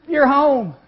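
loud, high cry of joy; joyful cry. Acted. Father hugging his son, loud joyful cry. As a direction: shout it out, overwhelmed with gladness.

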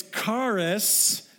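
charis slowly, with a hard k; correct charis. Shown as a demonstration. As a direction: draw out "charis" as KAR-iss, k not ch. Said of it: "to pronounce it correctly".

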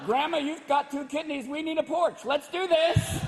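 mock eager grandson. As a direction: talk grandma into it brightly. Comic voices son selling grandma.

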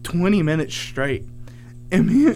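ordinary delivery

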